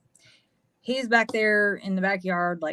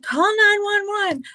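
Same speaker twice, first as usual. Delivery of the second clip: high, louder urgent command; panicked order; 13 semitones higher, 4 dB louder than usual. Retold or imitated. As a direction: yell it at your daughter in a panic. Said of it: "I'm like".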